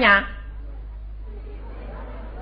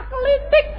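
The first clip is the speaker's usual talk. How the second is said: imitated click sound. Preacher mimics a sound effect.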